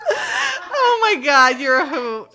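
slow, high, laughing oh my God. Real, not acted. Laughing at her friend's hot tub science story. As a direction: draw it out, breaking into laughter.

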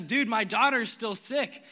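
a worried father pushing to hurry; freaking out. Retold or imitated. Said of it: "Jairus is probably freaking out".

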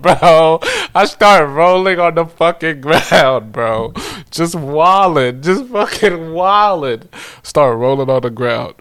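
loud, high, hyped-up storytelling; wild excitement. Real, not acted. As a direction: belt out the climax, half laughing. Excited climax of rolling on ground.

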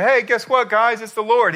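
loud, high-pitched news-breaking; acted thrilled call-out; excited announcement. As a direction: announce it excitedly to the whole crew. Acting John's excited announcement to the boat.

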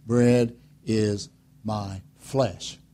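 very slow, each word weighed; slow solemn Jesus quote. Retold or imitated. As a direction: lay it down slowly and gravely. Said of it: "gets their goat when he says".

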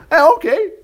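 high, loud offhand okay; breezy mock-careless agreement; casual shrug.

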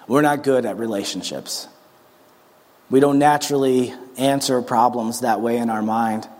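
earnest closing prayer. Acted. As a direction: pray over the congregation gently. Leads prayer.

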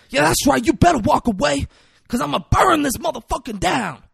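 high, loud imitated rant; full-blast movie villain tirade; Denzel Washington. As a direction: shout threats at the whole neighborhood. Yelling after crowd as Denzel.

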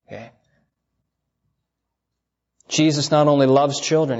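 ordinary delivery

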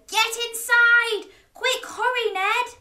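storybook knights shouting in alarm; urgent shout. A narrator's reading. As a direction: call out urgently to get someone to safety. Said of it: "The knights all shouted".

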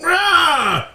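high mock roar; dinosaur voice. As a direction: roar like a playful monster. Couple roleplays zombie roar.

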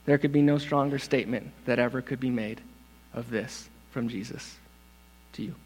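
drops soft; hushed awe. Real, not acted. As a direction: let it fall to a hush. Awed hush after climax.

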